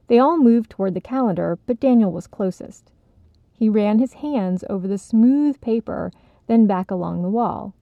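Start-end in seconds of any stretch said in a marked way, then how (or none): none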